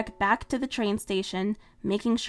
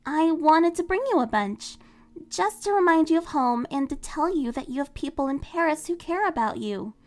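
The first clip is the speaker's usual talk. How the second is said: flustered Marinette; 6 semitones higher than usual. Acted. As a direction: laugh it off, then eager. Marinette explains.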